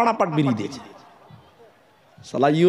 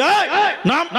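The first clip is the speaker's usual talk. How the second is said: higher, laughing mock order; mock bully shout; 8 semitones higher, slower than usual. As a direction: play the stick-wielding thug. Thug ordering cyclist off.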